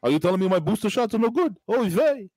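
put-on kvetch it like an old Yiddish uncle; Yiddish accent. Joking stereotype voice.

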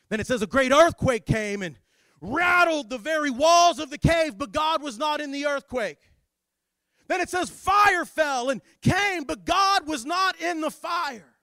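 high, loud dramatic scripture telling; full-force preaching. Acted. Preacher telling of earthquake and fire, full-force preaching. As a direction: thunder out each disaster, stretching the key words.

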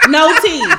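ordinary talk, nothing marked

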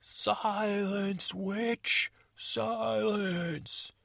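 high ringing command; commanding shout. Acted. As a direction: bellow it down from the battlements. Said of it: "the princess".

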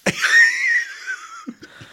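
high loud laughing fit; burst of real laughter. Real, not acted. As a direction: break up laughing helplessly. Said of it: "Are you trying not to laugh?".

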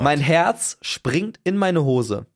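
very slow, word by word; dramatic slow delivery. Retold or imitated. Storyteller facing the horrified repairman, dramatically slowed. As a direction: stretch out every word for suspense. Dramatic climax, repeated for effect.

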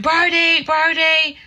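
high, desperate repeated calling; retold frantic scream. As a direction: scream his name across the street. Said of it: "I'm screaming".

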